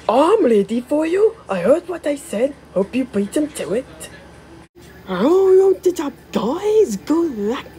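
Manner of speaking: chirpy cheer for the team; eager engine voice. Acted. Little engine cheering guys on, high voice. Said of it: "test voicing characters".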